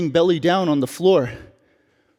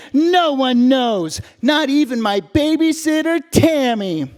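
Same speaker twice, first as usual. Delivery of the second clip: ordinary delivery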